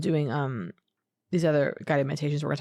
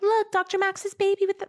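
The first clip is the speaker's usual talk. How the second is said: high, gushing squeal of delight; gushing fan squeal; excited squeal. Retold or imitated. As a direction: squeal it like you just spotted him. Excitedly showing a friend his baby.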